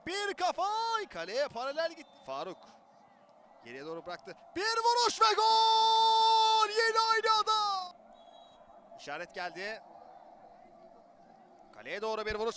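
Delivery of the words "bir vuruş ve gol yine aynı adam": stretched goal scream; screaming football commentator. Real, not acted. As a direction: yell the goal long and loud. Goal call.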